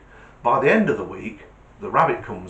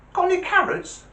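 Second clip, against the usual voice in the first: very high, squeaky little rabbit voice; joke rabbit; 3 dB louder than usual. Acted. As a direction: pipe it up high as a cheeky bunny.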